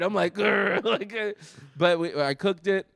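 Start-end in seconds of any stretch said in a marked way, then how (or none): 0.3-0.8 a playful annoyed growl; mock growl. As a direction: growl like a grumpy teenager. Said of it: "I'm annoyed".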